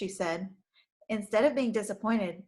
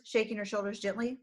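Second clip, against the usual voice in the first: usual speaking voice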